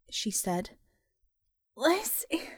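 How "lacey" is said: high, soft, fragile; faint moan. Acted. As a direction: barely get the name out. Woman says her name faintly.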